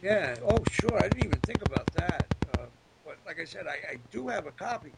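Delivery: ordinary talk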